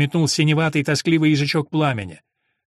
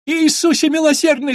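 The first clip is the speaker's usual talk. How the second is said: much higher, loud frightened wail; wailing shriek; 12 semitones higher, 6 dB louder than usual. Acted. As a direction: shriek it out in panic. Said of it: "завопила Хасинта". (испуганный вопль)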